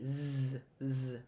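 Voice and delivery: "z" as a voiced buzz; sustained zzz, twice; hard S. Shown as a demonstration. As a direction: buzz a low, soft "z" sound. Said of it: "A hard S sounds like a Z".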